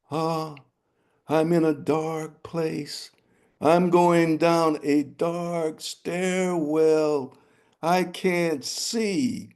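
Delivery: high, slow rooster voice; wondering rooster. Acted. Rooster inside the king's belly, high and slow. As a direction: drift down slowly, dreamy and curious.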